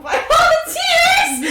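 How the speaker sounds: high, loud laughing cry; a laughing shout; laughing outburst. Real, not acted. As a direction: burst out in delighted laughter. Bursts out laughing.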